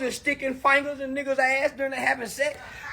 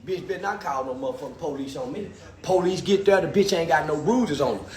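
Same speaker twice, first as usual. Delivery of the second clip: Uncle Wayne; 9 semitones lower, 4 dB quieter than usual. Retold or imitated. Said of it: "he be talking".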